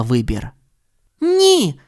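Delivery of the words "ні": high, firm refusal. A narrator's reading. Judy refuses Milton, firm. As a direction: refuse with no doubt. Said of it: "твердо сказала крольчиха".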